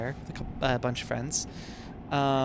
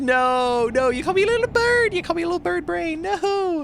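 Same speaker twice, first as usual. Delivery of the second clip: mock outrage; 14 semitones higher, 5 dB louder than usual. Hammed-up outrage at bird brain.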